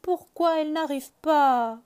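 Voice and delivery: high, loud pleading rabbit voice; impatient little rabbit. Acted. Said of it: "Il demande".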